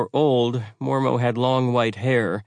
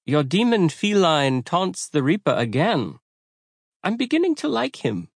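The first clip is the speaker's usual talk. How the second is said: put-on light German accent; 8 semitones higher than usual. A narrator's reading. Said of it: "in a light German accent said".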